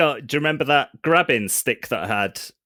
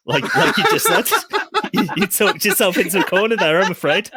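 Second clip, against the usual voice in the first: giggling through the words; laughing while teasing; 11 semitones higher, 5 dB louder than usual. Real, not acted.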